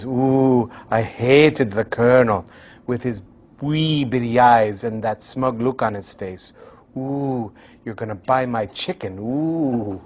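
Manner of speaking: a hammed-up Scottish grumble; poor Scottish accent. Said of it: "forgive my very poor Scottish accent".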